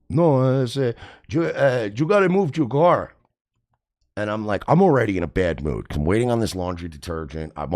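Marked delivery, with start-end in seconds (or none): 0.1-3.1 lightly mocked Cuban accent, raised pitch. Stranger telling a renter to move his car, mock Cuban accent. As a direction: put on a Cuban guy's accent. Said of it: "Fucking Cubans".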